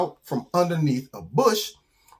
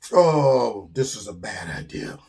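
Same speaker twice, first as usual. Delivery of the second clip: sinking regret; 6 semitones lower, slower than usual. Acted. Bird realizes mid-jump he will fall, dismayed. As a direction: let it sag from groan to low admission.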